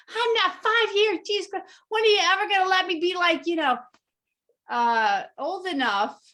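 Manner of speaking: pissed off. Retold or imitated. Retelling her comeback to a cocky friend, pissed off. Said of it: "I used to get so pissed".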